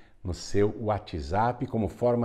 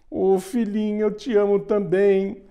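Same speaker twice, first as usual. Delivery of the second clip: king's voice pitched much higher and louder; the king tells his eldest daughter that he loves her too, touched; moved king; 11 semitones higher, 4 dB louder than usual. Acted. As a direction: gush it like a deeply touched father-king. Moved king answers second daughter.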